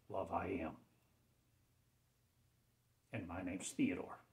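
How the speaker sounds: ordinary delivery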